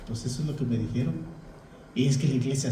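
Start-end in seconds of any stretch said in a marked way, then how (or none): 0.1-1.1 quiet reply. Retold or imitated. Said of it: "Le dice".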